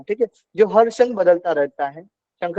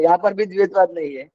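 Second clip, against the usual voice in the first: ordinary talk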